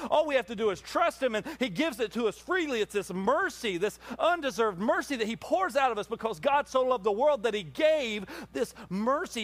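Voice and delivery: high, rising preacher voice; fervent preaching. Real, not acted. Pastor pressing mercy on the congregation, fervent preaching. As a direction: lift it like a Sunday climax.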